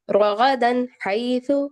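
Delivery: slow, drawn-out tajweed recitation; Quran recitation. Acted. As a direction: recite the verse slowly and melodically.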